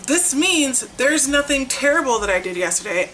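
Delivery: ordinary talk, nothing marked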